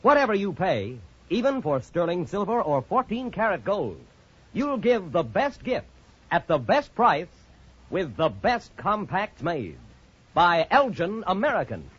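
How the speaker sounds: usual speaking voice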